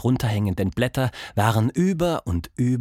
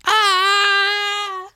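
very high, loud flying scream; a tiny creature shrieking as it's flung; 5 dB louder than usual. Acted. Pink caterpillar flung through the air, very high loud scream. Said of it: "schrie sie".

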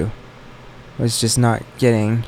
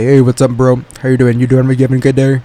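lightly put-on casual bro greeting, a bit louder; mock straight bro voice; 5 dB louder than usual. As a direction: play it cool like a straight guy. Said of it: "enter my straight voice".

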